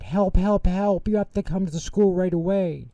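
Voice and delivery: high, loud frantic plea; panicked call for help. A narrator's reading. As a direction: cry out for help. Ben panics on the phone.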